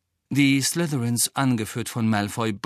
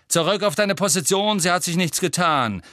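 high, raised commanding bark; barked order; 7 semitones higher, 4 dB louder than usual. A narrator's reading. Angelina orders Ron back to position, barked order. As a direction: bark the player back into place. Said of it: "bellte Angelina".

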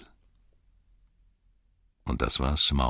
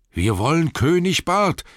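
much higher, loud demand; shouting crowd; 13 semitones higher, 7 dB louder than usual. Acted. Townsfolk near the Master shout back, loud demand. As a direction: shout him down. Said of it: "riefen die".

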